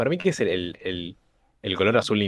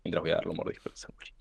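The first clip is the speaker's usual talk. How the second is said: unvoiced whispered aside; whispering; 3 semitones lower, 7 dB quieter than usual. Real, not acted. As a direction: whisper it off to the side. Aside to cohost while about eating.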